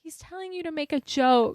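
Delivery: loud, high exasperated correction; retold frustrated outburst; exasperated explaining. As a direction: spell it out at full volume. Acting ex shouting the correction.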